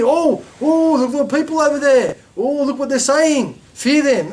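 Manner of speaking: high-pitched mock alarm, spreading fear; alarmist munafiqeen. Preacher mimics hypocrites warning believers about the enemy, mock-alarmed. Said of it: "That's what they were saying, the munafiqeen".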